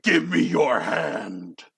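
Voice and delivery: very slow, rough giant's order; commanding giant. Acted. Giant to trembling volunteer, slow menacing order. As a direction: command it slowly and heavily.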